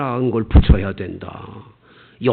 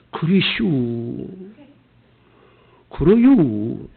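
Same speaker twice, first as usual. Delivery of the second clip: slow, drawn-out Chungcheong dialect; Chungcheong accent; 2 semitones lower, much slower than usual. Retold or imitated. Pastor imitates Chungcheong folks, slow Chungcheong accent. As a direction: stretch the Chungcheong endings lazily. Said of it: "충청도 사람은".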